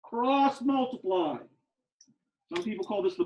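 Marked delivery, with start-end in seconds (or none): 0.0-1.4 "Cross multiply" said very slowly; drawn-out repetition. Shown as a demonstration. Teacher drilling the rule into students, slow and drawn out. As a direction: stretch out each word of "Cross multiply".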